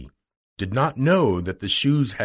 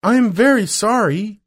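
high, loud, flustered apology; frightened girl; 10 semitones higher, 6 dB louder than usual. A narrator's reading. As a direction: apologise in alarm, pitched right up. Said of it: "She was frightened".

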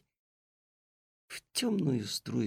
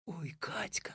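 soft awed whisper; 4 semitones higher, 11 dB quieter than usual. Acted. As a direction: breathe it in amazement. Boy amazed in silent attic.

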